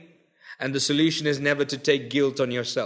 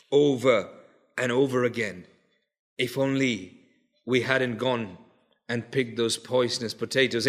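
hushed preaching; 5 semitones lower, 4 dB quieter than usual. Acted. Preacher musing softly to a grieving congregation, hushed. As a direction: draw them in with a hushed voice.

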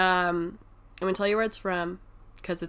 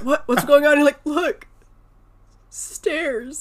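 high, half-hearted crying voice; mock crying; 7 semitones higher, 10 dB louder, slower than usual. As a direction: play him tearful and a little whiny, mildly. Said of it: "He's, like, crying".

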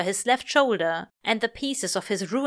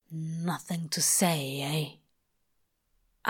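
slow, hushed, bitter whisper; 4 semitones lower, 6 dB quieter, much slower than usual. Acted. Crowley mocking the angel's silence, bitter whisper. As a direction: spit it softly through teeth. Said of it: "pushed through tight teeth".

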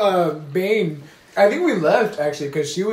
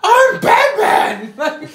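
Batman line, much higher and louder, dragged out slowly; gravelly Batman try; 8 dB louder, much slower than usual. Retold or imitated. Friend retelling the parody, wildly overdone Batman voice. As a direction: stretch out a ridiculous strained Batman voice. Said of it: "trying to find his voice".

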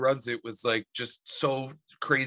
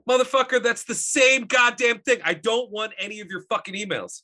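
higher, louder mock-furious tirade, slowing on the middle line; mad rant; 8 semitones higher, 4 dB louder than usual. Cursing out a marketing company over unwanted emails, in a higher, louder mock fury. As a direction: vent like you're cursing out a spam email. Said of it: "oh, I get so mad".

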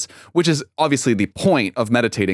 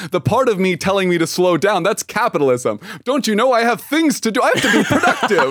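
mocking imitation; 6 semitones higher than usual. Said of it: "You're like".

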